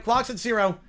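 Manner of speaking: ordinary talk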